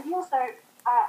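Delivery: usual speaking voice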